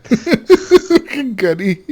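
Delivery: high, slow, laughing put-on British accent; mock British accent. Mocking absent friend Gunny in a fake British accent. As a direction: drag it out in a silly British accent. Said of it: "This is my British accent".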